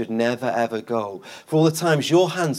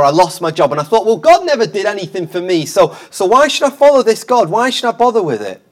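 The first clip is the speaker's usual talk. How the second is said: imitated grievance, building higher and louder; aggrieved complaint; 5 semitones higher, 6 dB louder than usual. Preacher mimics people who gave up on God, aggrieved complaint. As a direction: voice a doubter's rising protest. Voicing disillusioned people on the street.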